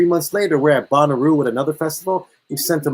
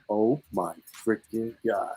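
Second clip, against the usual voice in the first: much softer, slow awed gasp of words; awed hush; 5 semitones lower, 10 dB quieter, slower than usual. Retold or imitated. Stunned friend hears about helicopter, soft and slow. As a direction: let it fall out of you in awe.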